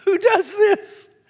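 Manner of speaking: who on earth would do that, drawn out high; mock incredulous. Real, not acted. Incredulous at imagined Jesus.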